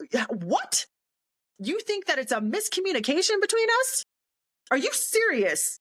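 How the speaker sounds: high-pitched betrayed disbelief; indignant outrage. Retold or imitated. Sergeant silently reacting to her defense counsel's betrayal, indignant. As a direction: replay an outraged inner rant at normal volume.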